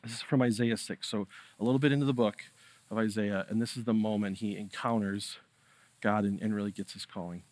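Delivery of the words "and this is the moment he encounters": normal talking voice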